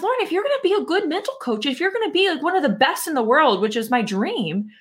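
a sudden wake-up call to herself; fired-up self-talk. Retold or imitated. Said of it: "that very night that I realized".

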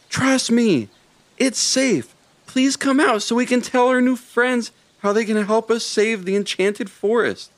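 high, bright small critter voice. A narrator's reading. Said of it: "called out Charlie".

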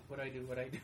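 soft, breathless panic; quiet frantic repetition; hushed panic. Retold or imitated. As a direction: mutter like a kid caught at the TV.

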